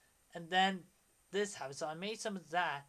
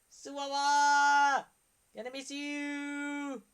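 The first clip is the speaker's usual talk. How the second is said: drawn-out high call of goodbye; slow, high goodbye cry; high farewell call; 7 semitones higher, 7 dB louder, slower than usual. Retold or imitated. Yelling goodbye in screenshot.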